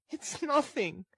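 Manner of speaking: put-on very high, small girl's voice; high, fragile character voice; weak girl's voice. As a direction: brush it off in a high register. Narrator voices the exhausted girl.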